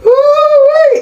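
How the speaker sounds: high, loud exclamation; woo-wee. Real, not acted. After being charged, a high, loud woo-wee. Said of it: "exclaims at being charged".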